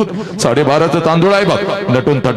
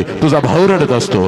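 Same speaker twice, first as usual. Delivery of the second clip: ordinary talk, nothing marked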